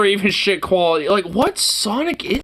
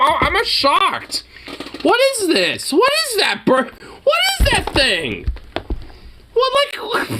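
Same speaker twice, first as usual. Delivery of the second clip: high, rising disbelief at what he sees; staring at a friend's burger photo in real shock; 10 semitones higher than usual. Real, not acted. Mouth hanging open, higher and higher. Seeing the burnt burger on video.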